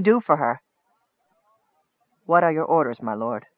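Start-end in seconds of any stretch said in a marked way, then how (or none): 2.2-3.5 quiet, deferential question; subdued, dutiful inquiry; respectful. A narrator's reading. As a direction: let the title drop low and soft. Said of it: "the sergeant asked respectfully".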